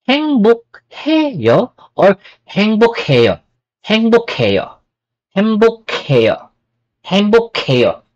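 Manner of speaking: 행복해요 said haengbokhaeyo with a puff of air; aspirated k. Shown as a demonstration. Teacher drilling the aspirated K. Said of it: "Mas maraming air".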